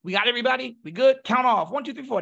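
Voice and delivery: high, loud barked commands to the group; drill instructor calling. Retold or imitated. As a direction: call the count-off like a sergeant. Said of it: "He says".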